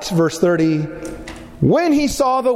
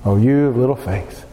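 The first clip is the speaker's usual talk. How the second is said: low gentle rebuke; 7 semitones lower than usual. Retold or imitated. Repeats Jesus' words, lower.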